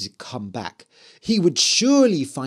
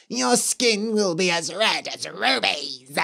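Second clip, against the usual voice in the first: eerie cursing witch; lightly voiced spooky witch; 4 semitones higher than usual. A narrator's reading. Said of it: "said the Red Witch".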